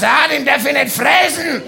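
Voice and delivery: high, loud laughing shout, slowing at the end; loud, laughing mock panic over forbidden ham; mock alarm. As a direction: yelp it through laughter as a joke. Imagined reaction to beeping ham.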